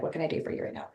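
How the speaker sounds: breathy whisper, tender; whispered comfort. Retold or imitated. Speaking tenderly to her fearful part, whispered. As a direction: whisper it to a scared child. Gentle self-talk to inner part.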